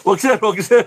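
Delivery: high, anxious pleading; imitating the nervous organizers begging. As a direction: beg him not to, mock-worried. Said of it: "organizers pleading".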